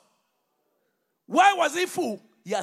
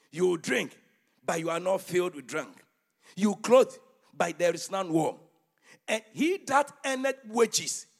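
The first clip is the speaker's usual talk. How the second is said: preaching at full force; 4 semitones higher, 12 dB louder than usual. Acted. Preacher reading Haggai aloud to his church, booming. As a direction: thunder the prophet's words over the congregation.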